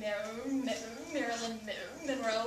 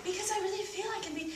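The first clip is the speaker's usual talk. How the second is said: mock sexy voice; 8 semitones higher than usual. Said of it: "talking like this".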